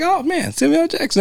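high, thrilled gasp of delight; excited. Retold or imitated. Remembering the narrator's voice, starstruck and high. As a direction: gush like a starstruck fan.

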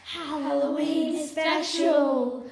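slow announcer voice. Acted. Jingle tag line.

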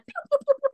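high, quick "pew" zapping sounds; imitated zaps. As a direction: mimic light shooting off in bursts. Angels flying out like light.

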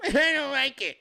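put-on high, childish whine; put-on high sulky protest; mocking complainer. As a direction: imitate a squeamish objector. Mimics those who object.

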